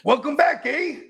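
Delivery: mock Canadian accent. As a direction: tease in a put-on Canadian accent. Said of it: "They don't even really talk like that".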